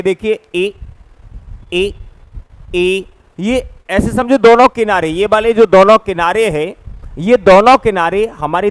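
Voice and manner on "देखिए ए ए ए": ए said alone, drawn out; vowel demo. Shown as a demonstration. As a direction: demonstrate the vowel ए for students. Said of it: "अब अपन उच्चारण करते हैं". (ए का धीमा प्रदर्शन)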